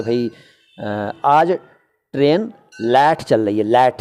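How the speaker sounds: drawn-out rural UP way of saying लेट; rural UP Hindi. Retold or imitated. Teacher mimics a UP villager at the station, drawn out. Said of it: "UP के कुछ शहरों में इस तरह से".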